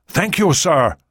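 calling out thanks over the rotor noise; shouted thanks. Acted. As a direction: raise the voice to be heard. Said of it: "the Dunkar soldier shouted".

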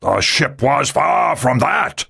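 slow, forceful admiral's voice; said loudly. A narrator's reading. Admiral correcting Ri about the ship, forceful. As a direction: press the point hard and slow. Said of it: "the admiral said loudly".

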